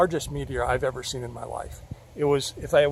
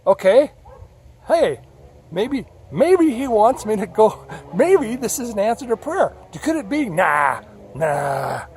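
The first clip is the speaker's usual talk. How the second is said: loud, higher, anxious self-talk; doubting himself; 7 semitones higher, 8 dB louder than usual. Retold or imitated. Lost driver doubting God answers, loud and high. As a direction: argue yourself out of hope, raised and high. Narrator voicing his own doubts.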